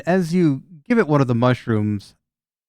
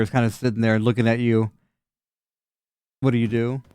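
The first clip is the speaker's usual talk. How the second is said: usual speaking voice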